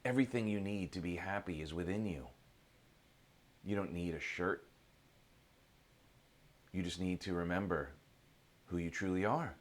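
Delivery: low, gentle counsel with long pauses; calm sage. Acted. Old sage counsels the confused seeker, calm and wise.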